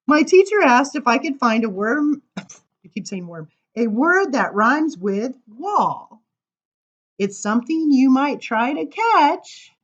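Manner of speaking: lively sing-song verse reading; poem reading. Acted. Reading a funny poem to the crowd, sing-song. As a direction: bounce through the rhymes for listeners. Reads rhyming poem aloud.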